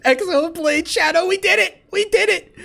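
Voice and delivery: high, loud triumphant laughing; giddy relief. Real, not acted. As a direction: burst out in real joy. Escaped with all the codes.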